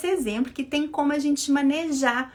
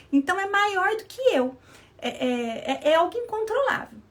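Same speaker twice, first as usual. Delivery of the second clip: mãe irritada exaltada; 6 semitones higher than usual. Retold or imitated. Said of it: "Tô lá gritando com o menino".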